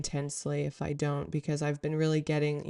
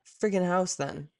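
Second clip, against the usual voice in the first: higher, louder mock-angry complaint; venting at God while scrubbing the house, mock angry; angry retort; 4 semitones higher, 5 dB louder than usual. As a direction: snap back at spirit in retold frustration.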